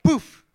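high, loud imitated "poof"; magic poof. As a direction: pop it out suddenly like a magic trick. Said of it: "An angel went like".

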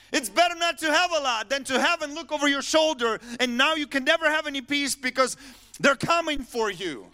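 loud, high-pitched defiant preaching; full-force impassioned rejection; shaking oneself awake. Acted. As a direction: cry out a fierce refusal of the devil. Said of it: "I shook myself. I'm like".

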